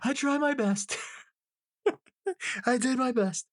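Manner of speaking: imitated sobbing wail, very high; Dane Cook crying bit. Quoting a comedy bit about a good cry, mock crying. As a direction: squeal it out as a mock blubbering cry. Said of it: "have a really good cry".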